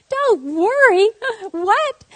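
high, loud, drawn-out incredulous echo; hammed-up shriek of disbelief; freaking out. Mother freaking out at being told don't worry, incredulous. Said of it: "by then I am freaking out".

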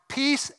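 "Peace" said high and emphatic; exclamatory peace. Shown as a demonstration. Pastor showing the exclamation point on "Peace". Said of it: "exclamation point".

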